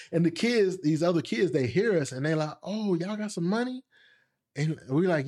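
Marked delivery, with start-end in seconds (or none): 2.6-3.8 lightly voiced curious kid, higher pitch; mock a prying kid's question; high-pitched mock interest; nosy kid, imitated. Other kids pry about money.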